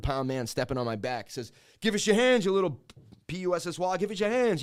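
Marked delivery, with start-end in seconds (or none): 1.8-4.6 loud, high imitated cop command; barked order. Cop demanding hands from a pinned suspect, barked order. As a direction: shout it down at a suspect.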